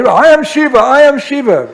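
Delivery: high, loud declaration; grandiose shout. Retold or imitated. Nitai on a bull, shouting that he is Shiva. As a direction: proclaim it riding the bull.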